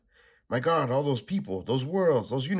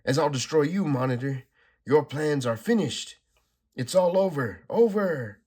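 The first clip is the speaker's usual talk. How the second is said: high, louder, slowing, building cry; triumphant shout; 6 semitones higher, 6 dB louder than usual. Acted. Supergirl tells the Monitor his plans are over, rising shout. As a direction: hammer home that it's finished.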